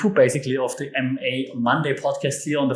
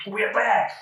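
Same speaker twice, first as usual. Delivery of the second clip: high-pitched, falling "we're back"; playful announcement; 7 semitones higher than usual. Pretend. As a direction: coax the cat in a high voice. Prompting the cat to speak.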